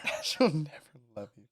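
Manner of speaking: soft imitating whisper; whispered echo. Friend echoing the cruel line to female companions, whispered. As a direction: repeat it back in a whisper. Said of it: "just whisper in their ear".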